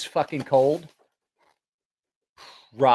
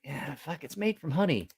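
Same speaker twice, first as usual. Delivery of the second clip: resigned giving in; 3 semitones higher, 8 dB quieter than usual. Retold or imitated. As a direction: concede it under your voice. Retelling own giving in.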